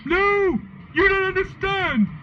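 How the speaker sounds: high falsetto wail of protest; sad scream. A narrator's reading. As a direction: scream it like a hammed-up tragic plea. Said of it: "screamed Draco sadly".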